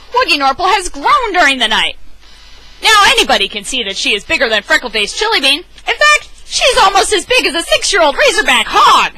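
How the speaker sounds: an excited owner bragging about his growing cat; bouncy, proud storybook dad; excited Lunchbox Louie. Acted.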